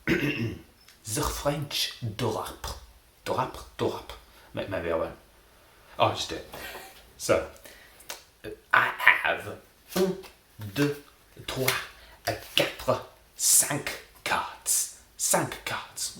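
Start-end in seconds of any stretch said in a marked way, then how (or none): 1.1-4.1 attempted French accent, slowly drawn out. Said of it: "I will be doing a French accent". 8.7-16.2 slow, counted out in a mock French accent. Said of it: "I will be doing a French accent".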